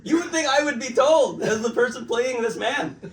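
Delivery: loud, high, amused outrage; laughing indignation. Real, not acted. Actor complaining nobody told him, laughing disbelief. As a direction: protest through laughter.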